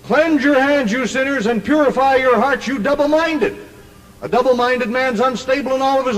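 high, driving preacher's delivery; emphatic scripture quote. Acted. Preacher repeating the verse for King James doubters, high and emphatic. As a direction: drive the verse home, pitched high without lingering.

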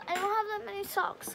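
ordinary talk, nothing marked

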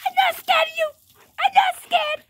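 high-pitched warrior dog voice; mock bravado. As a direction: boast in a high play voice. Voicing boastful dog in mock fight.

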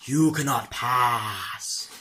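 lightly hammed-up wizard decree; mock wizard, slowed. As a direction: stretch "you cannot pass" very slowly like a wizard. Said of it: "It's kinda like".

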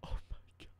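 whispered oh my God, barely voiced; breathless whisper. Real, not acted. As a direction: let it out on a stunned breath. Overwhelmed after sweet fan chat.

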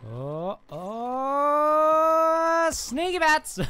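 high, loud drawn-out cry; excited yell. Pretend. Player springing the sneaky bat attack, yelling high. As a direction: burst out of stealth with a long whooping shout.

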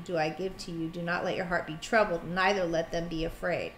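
soft, measured Bible reading; quiet scripture reading. Acted. Wife rereading the verse to her husband, soft and solemn. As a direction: read the verse softly and reverently.

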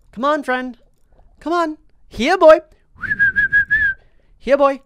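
put-on high, loud here-boy call; bright pet-calling shout. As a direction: coax him along like a dog. Coaxing a fish to follow.

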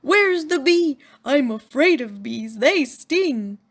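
frightened yell of a scared moose; panicked moose shouting from under the desk. Acted. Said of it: "He yelled".

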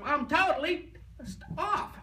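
high, slowed, stretched-out yell; mock angry yell. Woman yelling to the whole group, mock angry. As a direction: imitate her shouting her frustration at the trainer. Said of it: "she yells out to the whole group".

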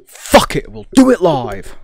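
high, loud, exasperated outburst; shouted quote. Retold or imitated. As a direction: yell it like a meltdown on air. Quoting the famous on-air outburst.

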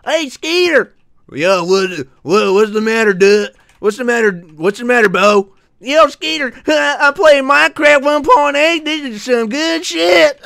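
cowboy voice. Pretend. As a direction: holler to a buddy like a cartoon cowboy. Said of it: "I'll do the cowboy voice".

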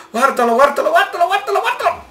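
very high squeal breaking into laughter; terrified laughing squeal. Real, not acted. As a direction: squeal in fright. Raptor jumps at camera.

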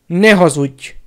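loud furious command; foaming, forceful outburst; seething rage. A narrator's reading. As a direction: explode at the liar.

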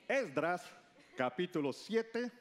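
ordinary talk, nothing marked

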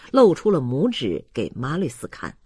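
plain reading voice

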